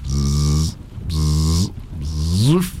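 three separate buzzes; bee buzz. A narrator's reading. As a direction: demonstrate a bee's buzz. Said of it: "Imitate a bee".

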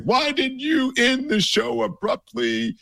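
high, slow put-on mom voice, puzzled; imitating mom. Son imitating his mom asking why he ended abruptly, high slow mom voice. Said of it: "even my mom was like".

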